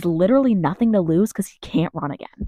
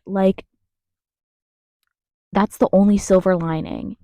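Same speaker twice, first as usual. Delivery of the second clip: usual speaking voice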